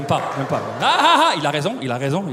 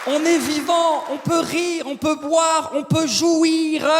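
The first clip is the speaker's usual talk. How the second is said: rousing crowd call; 6 semitones higher, slower than usual. Acted. Comic rallying the audience, high stretched exclamations. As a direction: fire up the whole room.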